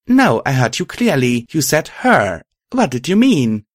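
high, loud accusation; indignant cross-examination; angry demand. A narrator's reading. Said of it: "I asked angrily".

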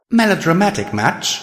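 high, mocking question; sarcastic. A narrator's reading. Radiant Star answering Pride's proclamation, sarcastic. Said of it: "I said sarcastically".

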